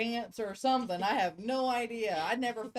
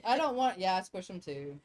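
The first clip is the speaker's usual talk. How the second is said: ordinary talk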